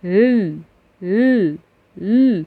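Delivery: "ل" as a short stopped l; sound demo. Shown as a demonstration. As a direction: sound out the still "ل" for the class. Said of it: "سكون".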